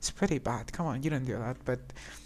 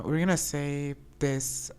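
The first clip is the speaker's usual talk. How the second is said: ordinary delivery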